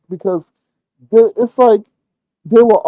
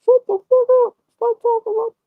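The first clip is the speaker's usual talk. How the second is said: much higher put-on voice snapping shut up; hammed-up high-pitched snap; mock telling off. What he would tell the woman, in a high mock snap. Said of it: "I know I would have said".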